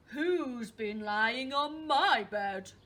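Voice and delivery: big, slow Father Bear boom; loud, drawn-out storybook bear shout. Acted. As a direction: bellow it slowly like an indignant big bear. Father Bear's loud line in the story.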